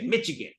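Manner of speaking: "Michigan" said with a ch, "Mitchigan"; brother's joke ch in "Michigan"; jokey mispronunciation. Retold or imitated. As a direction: put a hard ch in the middle of "Michigan". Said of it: "he would always say".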